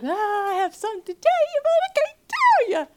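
very high, loud, fast whine; whiny squeal. Retold or imitated. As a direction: squeal like a teen hiding a secret. Her childish whining reply.

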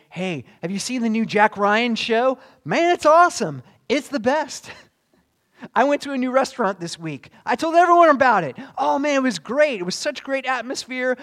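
loud, high, gushing recommendation; acted burst of enthusiasm; excited raving. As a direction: rave like a fan telling friends. Acts out fans raving about favorites.

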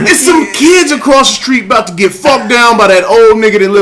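loud, high gossiping shout; hammed-up loud neighbor; excited neighbor report. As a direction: holler the juicy news to the wife. Acts neighbor reporting to wife.

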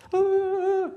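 high, loud sustained "ah"; mock meditation cry. Teacher imitating meditators his tradition avoids, high mock cry. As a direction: let out a high held wail, mocking showy meditation. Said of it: "You hardly see traditional meditation like this".

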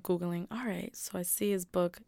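normal talking voice

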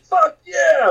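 high, drawn-out cheer of excitement; high-pitched retold celebration; hyped. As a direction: relive being totally hyped. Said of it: "They were all hyped".

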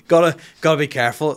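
loud, high, through laughter; words breaking into real laughter; laughing at slip. Real, not acted. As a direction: crack up while correcting yourself. Cracks up at own slip.

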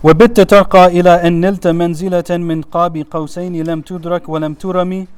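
quick chanted Arabic verse; poem recitation. Acted. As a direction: rattle off the poem rhythmically.

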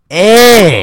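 high, loud hammed-up "Eh"; loud mock Canadian eh. Cohosts riffing on Canadian slang, loud high mock "eh". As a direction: yelp the eh like a cartoon Canadian.

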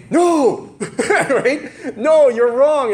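scolding parent. Retold or imitated. Parents shut you down.

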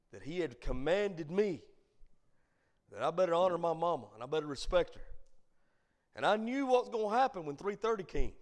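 loud, emphatic preacher's storytelling; impassioned preaching. Real, not acted. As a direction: drive the point home forcefully to the congregation.

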